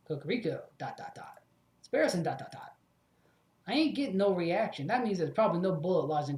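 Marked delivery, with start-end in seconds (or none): 0.8-1.4 breathy whispered scanner beeping; whispered beeps. Acted. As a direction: let the beeps fade into a whisper.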